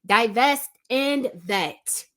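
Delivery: "Divest and vet" spaced out slowly, each word weighted; slow it right down so every word lands; slow deliberate emphasis. Shown as a demonstration. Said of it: "I'll say it again because I can't emphasize this enough".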